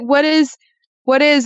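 mock-confused, pitch pushed up; high stumbling quote. Host imitating herself stuck on a long word, high-pitched. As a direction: raise the voice, fumbling for the word.

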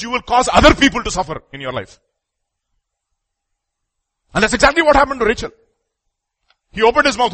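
high, loud pulpit warning; forceful preaching. Real, not acted. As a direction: drive the warning home to the congregation.

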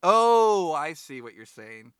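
long, loud "oh" as it clicks; dawning realization. Real, not acted. Host catching the co-host's meaning, loud dawning realization. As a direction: draw out a big oh of sudden understanding.

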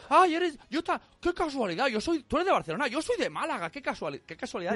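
high, eager fellow Spaniard abroad; gushing stranger impression. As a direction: gush like a pushy new best friend. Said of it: "y estás ahí".

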